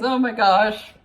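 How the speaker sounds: nervous laugh. Real, not acted. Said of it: "That's my nervous laugh".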